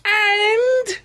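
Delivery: put-on sheepish girl confessing slowly; Amy voice. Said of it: "in comes Amy".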